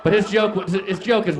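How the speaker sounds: usual speaking voice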